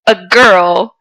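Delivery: usual speaking voice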